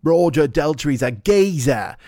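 slow, drawn-out mock Cockney; British gangster. Comic naming the singer a geezer, mock Cockney gangster. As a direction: stretch it out like a London gangster. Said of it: "British gangster films would call a geezer".

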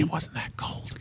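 hushed whispered protest; whispering. Real, not acted. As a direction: breathe it as a whisper. Playful argument with spouse.

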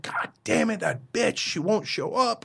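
retold angry rant; mock furious. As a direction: imitate fed-up crew cursing. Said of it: "people are furious".

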